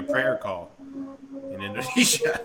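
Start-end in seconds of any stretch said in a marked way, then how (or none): 1.5-2.5 laughing. Real, not acted. Joking about a prayer call.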